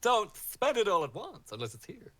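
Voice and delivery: wag a finger playfully; teasing green man. Acted. The green man teasing the party about gold, high and loud. GM voices the green man's quip.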